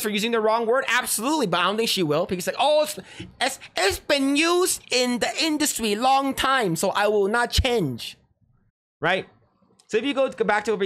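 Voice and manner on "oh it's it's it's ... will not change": high, slow mocking imitation; mock stubborn excuse. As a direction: put on her voice, drawing it out. Mimicking her refusal to apologize.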